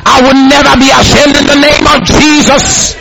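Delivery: high, sustained declaration; bold response. Shown as a demonstration. Believer answers the scripture's promise, boldly declaring. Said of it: "What is your response?".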